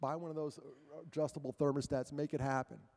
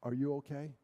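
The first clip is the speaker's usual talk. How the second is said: quiet, low caring voice; private gentle talk; 4 semitones lower, 4 dB quieter than usual. Retold or imitated. Speaker to kid pulled aside privately, quiet and caring. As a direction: pull them aside and speak softly. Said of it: "I pull 'em aside privately".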